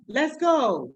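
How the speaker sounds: put-on high upbeat call to kids; rally the little ones; excited teacher. Shown as a demonstration.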